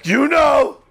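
high, exasperated parental shout. Retold or imitated. Parent to a kid who asks what they did, exasperated shout. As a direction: shout it at a kid playing dumb. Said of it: "And you're like".

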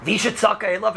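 usual speaking voice

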